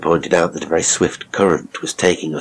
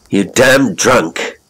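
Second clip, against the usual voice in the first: slow, loud angry insult; 5 semitones higher, 5 dB louder, much slower than usual. A narrator's reading. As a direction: spit out "damn" in anger. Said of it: "he said angrily".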